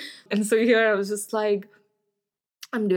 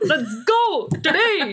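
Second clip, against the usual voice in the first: higher, drawn-out rallying call; mock hype; 6 semitones higher, 4 dB louder, slower than usual. Dance class rallying as Uptown Funk starts, mock hyped. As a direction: imitate a class getting fired up.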